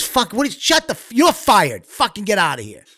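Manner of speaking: high, sputtering outburst that drops at the end; furious dismissal, imitated. Imitating the old guy firing a staffer, furious dismissal. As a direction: act out a boss cutting someone off in a rage. Boss fires staffer, retold.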